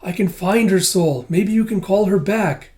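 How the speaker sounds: pleading like an anxious small creature; Kippa's voice. Retold or imitated. Kippa begging Master Ren to help Miss, high pleading voice. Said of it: "It's like".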